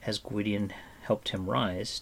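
ordinary delivery, nothing marked

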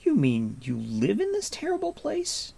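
put-on high, astonished boy; amazed. A narrator's reading. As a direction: ask in disbelief. Taryn asks, amazed.

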